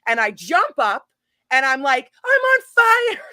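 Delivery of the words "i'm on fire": high, loud, stretched mock panic; retold alarmed cry. Storyteller retelling jumping up on fire, alarmed cry.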